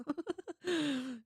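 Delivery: a high, breathy burst of laughter. Real, not acted. Laughing at a viewer's shocking rumour, a high burst of laughter.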